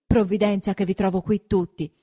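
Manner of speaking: put-on a terrified boy blurting out news; frightened, rushed report; breathless fright. A narrator's reading. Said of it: "riprese Menico ansante".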